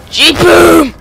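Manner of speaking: loud, high "boom" exclamation; excited outburst. Real, not acted. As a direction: blurt it out big and loud. Excited exclamation during gameplay.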